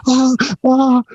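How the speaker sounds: high mock yelps of pain; pained cry. Tech with glue on his thumb, high mock pained yelps. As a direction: imitate a burned hand yelping.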